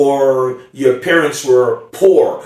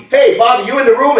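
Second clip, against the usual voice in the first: hey Bobby called across the room; calling out; 7 semitones higher, 4 dB louder, faster than usual. Acted. Gift giver calling across the room for Bobby. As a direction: call loudly across a crowded room.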